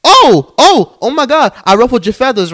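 high, loud fake gasps of outrage; mock shock. Podcaster mocking those with ruffled feathers, mock shock. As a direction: squeal it like a scandalised listener.

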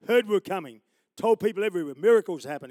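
loud fervent preaching climax; preaching at full force. Acted. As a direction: proclaim it to the whole hall.

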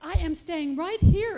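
put-on stubborn baby refusing to budge; insistent. A narrator's reading. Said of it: "insisted baby".

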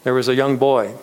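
ordinary talk, nothing marked

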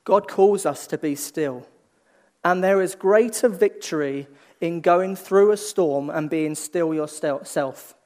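ordinary delivery

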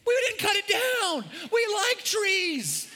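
laughing shout. Retold or imitated. Pastor protests to city, shouting with laughter. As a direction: shout it, cracking up.

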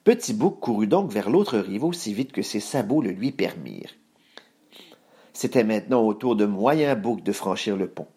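usual speaking voice

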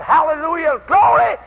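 high, loud cry of praise; retold praise shout. Preacher imitating mother leaping up in church, praise shout. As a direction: burst out as a shouting worshipper.

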